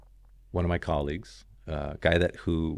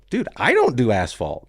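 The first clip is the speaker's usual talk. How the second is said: louder, higher "dude" in amused refusal; turning down an asphalt job, raised and insistent; emphatic protest; 6 semitones higher, 8 dB louder than usual. Retold or imitated. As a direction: push back hard on the offer. Retold refusal of job offer.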